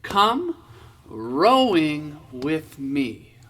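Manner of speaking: slow spoken lyrics, word by word; spoken slowly. Shown as a demonstration. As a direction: say the words slowly and clearly. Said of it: "Let's say it".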